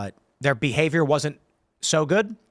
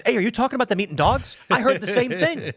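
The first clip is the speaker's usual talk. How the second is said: higher and a bit quick, eager to join in; eager gossip; 7 semitones higher than usual. Retold or imitated. Guy C walks up to the others, high and excited. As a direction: butt in all excited. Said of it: "guy C walks up and goes".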